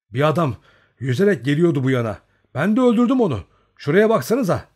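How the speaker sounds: heated, high-pitched explaining; excited insistence. A narrator's reading. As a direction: blurt out the news at full voice. Excited shooter insists on his kill.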